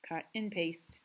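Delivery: normal talking voice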